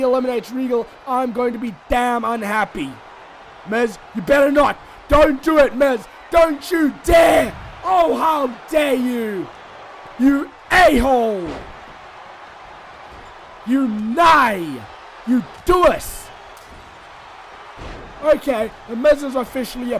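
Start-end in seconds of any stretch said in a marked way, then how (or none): 3.7-9.5 loud, slow, outraged warning at the screen; don't you dare, Miz, shouted; angry yelling. Real, not acted. Commentator yelling at Miz for eliminating Regal, furious shout. 10.2-16.3 bitter jabs at a player; angry insults. Real, not acted. Commentator insulting Miz after the elimination, angry.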